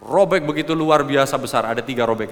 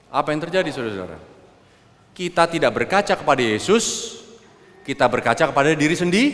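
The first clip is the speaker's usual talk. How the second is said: normal talking voice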